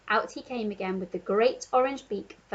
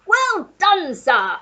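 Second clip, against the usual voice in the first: put-on loud hearty old Duke praise; Duke shouting; 8 semitones higher, 9 dB louder, much slower than usual. A narrator's reading. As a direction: bellow it like a delighted colonel. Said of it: "shouted the Duke".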